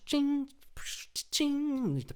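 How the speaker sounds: very high chiming ching; ching sound effect. Shown as a demonstration. Imitating the Zombies buy sound, high chime. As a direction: chime it like a game purchase. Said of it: "I'm even gonna make the sound".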